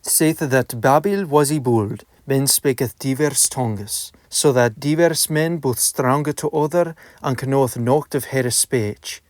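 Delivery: reading in reconstructed Middle English pronunciation; old vowels, 'nations' as nasjɔnz, 'out' as ut. Shown as a demonstration. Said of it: "I shall now begin reading Dialogue Between a Lord and a Clerk".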